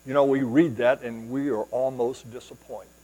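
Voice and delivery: ordinary talk, nothing marked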